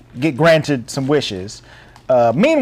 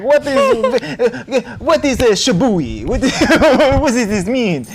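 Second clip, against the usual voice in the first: put-on French accent; 6 semitones higher, slower than usual. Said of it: "Wow, I am so sorry if you're from France".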